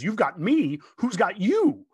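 mock alarm, rising loudly to the end; Margot Kidder. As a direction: imitate an incredulous rescued woman, pitch climbing on the question. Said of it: "Margot Kidder hilariously says".

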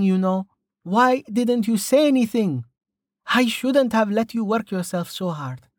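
lightly voiced concerned healer; Amelia's voice. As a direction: chide him like a worried friend. Amelia scolds Rain.

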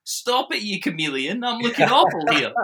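high-pitched playful complaint; mock protest. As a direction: jokingly scold someone for showing you up. Playful protest at being outdone.

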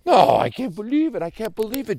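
loud, high cry of disbelief; disbelieving. Acted. Joey reels at the talking bird, disbelieving. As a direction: blurt it out in amazement. Boy amazed bird talks.

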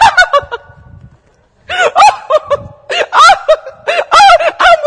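high sung squeal of delight; joyful shriek. Retold or imitated. Daughter bursting from her room, squealing with joy.

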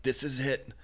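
lightly mock the dad, as if dying of the flu; dramatic dying dad. Imitating dad's melodramatic goodbye when he gets the flu, hammed up. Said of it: "he's like".

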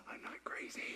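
tiny awestruck whisper; amazed relief. Acted. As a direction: whisper it to yourself, barely audible. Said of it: "What do you think happened to Mary's heart".

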